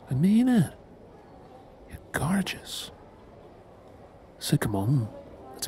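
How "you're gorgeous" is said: soft whispered compliment; whispering. Acted. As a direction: breathe it softly to her.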